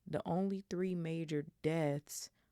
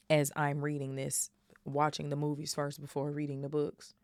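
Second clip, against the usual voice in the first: ordinary delivery, nothing marked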